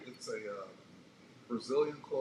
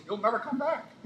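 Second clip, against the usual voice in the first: put-on Indian accent; 11 semitones higher, 6 dB louder than usual. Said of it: "in that great Indian accent of his".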